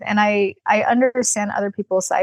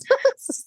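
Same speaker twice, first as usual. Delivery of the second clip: high laugh. Real, not acted. Laughs at own story.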